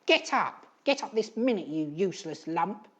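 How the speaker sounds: high, loud holler. Acted. Edith hollering at Eric, a high loud shout. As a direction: bark it at the crying son. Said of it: "hollered his unsympathetic mother".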